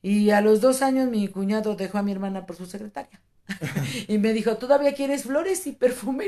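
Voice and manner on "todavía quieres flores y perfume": higher-pitched mock question; retold teasing. Wife quoting husband needling her about flowers, teasing. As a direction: lightly imitate a teasing husband. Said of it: "él me vacilaba con eso".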